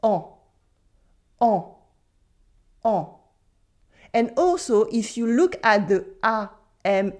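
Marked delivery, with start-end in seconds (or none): 0.0-3.0 "En" as one nasal vowel, silent N; French nasal "en". Shown as a demonstration. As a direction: model "En" for repeating, N not sounded. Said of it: "Repeat after me".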